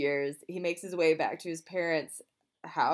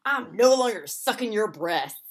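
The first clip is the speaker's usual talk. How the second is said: loud, defiant quitting line; loud mock rage quit; 6 dB louder than usual. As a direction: declare it loudly, mock defiant. Rage quitting a job, acted quote.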